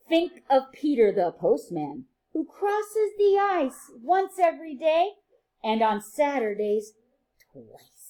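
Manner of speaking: bright, sing-song read-aloud for little ones; reading to children. A narrator's reading. Teacher reading a rhyming book to her class, sing-song and lively.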